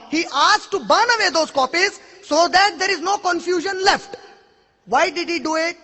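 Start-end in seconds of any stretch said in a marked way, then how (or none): none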